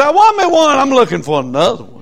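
high, loud brag that fades off; proud triumph. Acted. Preacher voicing what the congregation will say, loud triumph. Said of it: "You'll say".

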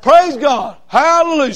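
preacher exulting; joyful praise. Real, not acted. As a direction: shout it out in praise.

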